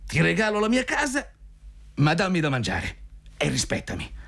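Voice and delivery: tirata animata e molto acuta; eccitato dalle proprie parole. A narrator's reading. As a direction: prova il discorso infervorandoti, con la voce in alto. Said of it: "Eccitato dalle sue stesse parole". (vecchio brillo che si esalta, acuto)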